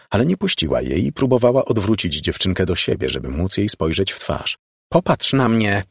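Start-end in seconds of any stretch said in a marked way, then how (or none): 4.9-5.8 raised, higher command; shouting. A narrator's reading. Margo grabs the girl and demands a look, shouting. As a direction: cry out an order. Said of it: "krzyknęła".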